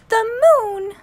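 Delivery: high, loud astonished cry; surprised cry. A narrator's reading. Jenny Wren cries out at Willie's plan, high and loud. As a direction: burst out in disbelief. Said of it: "cried Miss Wren".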